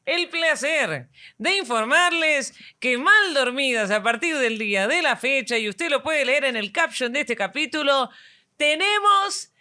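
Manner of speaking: pompous slow announcer voice; booming national announcer read; hammy stage actor. Pretend. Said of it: "con voz engolada de locutora nacional".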